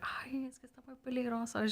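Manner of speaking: high worried whisper; fretful hushed mom. Retold or imitated. Mom quoted fretting in Spanish.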